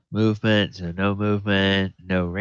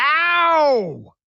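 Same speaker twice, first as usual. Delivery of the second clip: high, loud yelp; cartoon yelp of pain; 8 dB louder than usual. Danish getting whacked with the Morning Star, high yelp. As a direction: squeal like you just got whacked. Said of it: "He's like".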